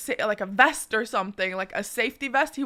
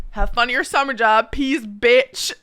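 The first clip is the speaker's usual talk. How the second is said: loud taunting goodbye; 7 dB louder than usual. Retold or imitated. Taunting the kid from car.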